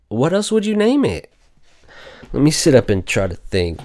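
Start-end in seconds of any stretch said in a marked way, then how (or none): 0.1-1.3 What else would you name it? asked far higher, in disbelief; squeaky disbelieving what else would you name it; high indignant question. Real, not acted. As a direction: fling the question up in outrage. Asking indignantly about the rename.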